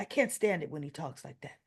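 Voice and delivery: usual speaking voice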